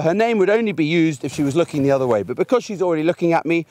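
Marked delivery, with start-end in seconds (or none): none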